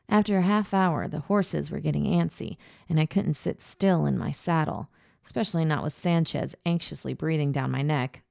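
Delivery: usual speaking voice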